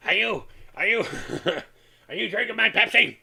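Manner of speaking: imitating mom catching the kids at her soda; mom yelling. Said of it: "And she'd be yelling".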